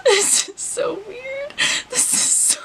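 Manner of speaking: high, teary half-laughing squeak; overwhelmed. Real, not acted. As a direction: squeak it out, close to laughing and crying. Overwhelmed by first cut.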